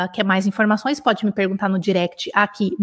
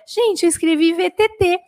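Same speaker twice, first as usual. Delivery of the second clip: quoting a student; 7 semitones higher than usual. Retold or imitated. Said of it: "A Valéria".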